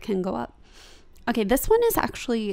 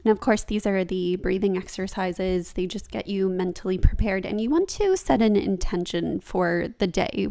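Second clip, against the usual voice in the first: ordinary talk, nothing marked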